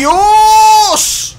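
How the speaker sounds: high, loud cry of alarm; startled shout. Real, not acted. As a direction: yelp it out in shock. Crushed in game, cries out.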